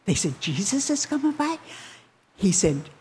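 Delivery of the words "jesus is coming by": high, quick excited question. Retold or imitated. Crowd telling of Jesus passing, excited. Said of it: "they got so excited".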